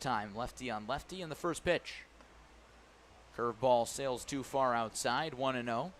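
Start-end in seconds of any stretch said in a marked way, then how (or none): none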